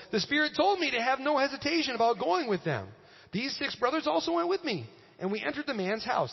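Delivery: high, projected reading aloud; Scripture reading. Real, not acted. Pastor reading Peter's account in verse twelve, projected reading.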